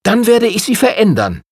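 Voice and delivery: high-pitched, challenging retort; defiant. A narrator's reading. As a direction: throw down the challenge to the prince. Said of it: "sagte Pietro herausfordernd".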